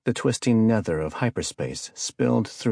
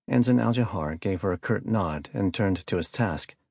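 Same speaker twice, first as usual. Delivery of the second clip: ordinary delivery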